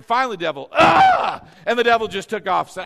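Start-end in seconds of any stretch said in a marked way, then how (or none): 0.7-1.4 startled yelp. Pretend. Devil flees.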